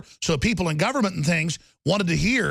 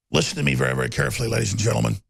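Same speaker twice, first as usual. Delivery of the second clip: ordinary talk, nothing marked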